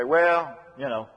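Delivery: lightly voiced reticent man, low and trailing off; a hint of an awkward, undemonstrative man; reluctant man. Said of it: "Some man will say".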